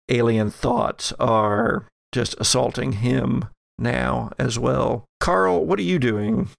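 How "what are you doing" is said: ordinary talk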